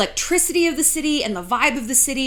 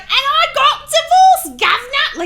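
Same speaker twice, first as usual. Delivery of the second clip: loud, high, exaggerated British accent; over-the-top British accent, pitched way up; 8 dB louder than usual. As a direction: holler it in a broad mock British accent. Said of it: "wild accent".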